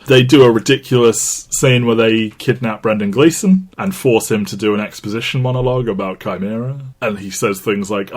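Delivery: ordinary talk, nothing marked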